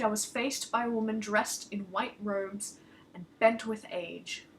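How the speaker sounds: ordinary delivery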